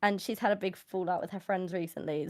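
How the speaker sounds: normal talking voice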